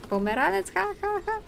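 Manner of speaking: high put-on "ha, ha, ha" after the word; exaggerated imitation of laughing at it; mock hilarity. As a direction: act tickled by a silly foreign word. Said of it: "this is hilarious".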